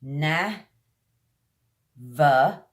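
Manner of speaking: Na, va split slowly, teeth on lip for va; syllable drill. Shown as a demonstration. Teacher drilling learners syllable by syllable, slow and clear. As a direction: break Na, va into parts, biting the lip on va. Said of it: "kind of put your teeth on the front lip".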